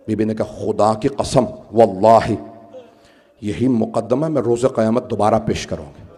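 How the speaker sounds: low, hushed preaching with long pauses; solemn sermon narration. Acted. Preacher retells Sayyida's vow of a retrial on Judgement Day, low and solemn. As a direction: drop low and soft, weighing each phrase.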